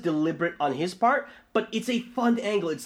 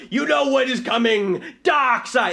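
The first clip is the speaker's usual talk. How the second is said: raised, higher announcing villain; hammy booming villain announcement; Steppenwolf announcing; 7 semitones higher, 5 dB louder, slower than usual. Retold or imitated. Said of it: "when he announces".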